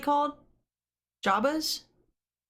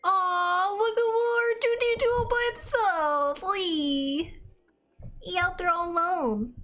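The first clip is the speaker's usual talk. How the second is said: high, cooing sympathy; cooing pity; 8 semitones higher than usual. Real, not acted. Viewer pitying R two D two all alone, high cooing voice. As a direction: coo over a poor lonely little thing. Pitying the little robot on screen.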